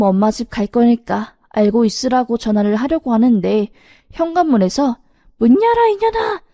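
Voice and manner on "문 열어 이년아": retold shout. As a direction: bellow it from outside the front door. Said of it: "하는 소리가 쩌렁쩌렁 들리는 겁니다".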